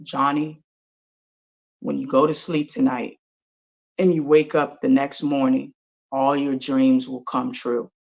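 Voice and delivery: low, soft tree voice; wise old apple tree. Acted. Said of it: "the apple tree says".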